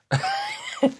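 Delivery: a quick squeaky cackle; a burst of high laughter after the cursing; short high laugh. Real, not acted. Laughing after swearing rant.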